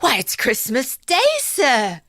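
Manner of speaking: put-on boy answering the old man in the window; street boy. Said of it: "the boy says".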